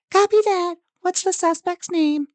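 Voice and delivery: dispatcher voice. Pretend. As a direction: answer calmly over the radio. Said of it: "the dispatch officer trying to radio this in".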